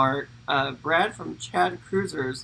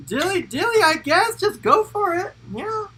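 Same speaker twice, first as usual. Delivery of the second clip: much higher, giddy cheer; squealy toast; 7 dB louder than usual. Pretend. Host toasting the new drink of the week in a giddy high squeal.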